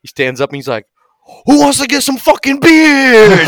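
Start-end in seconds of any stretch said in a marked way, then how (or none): 1.4-3.5 high, slow, full-out holler; rally the whole room at the top of your voice; rallying shout. Acted. Dude stands up as meeting ends, hollering.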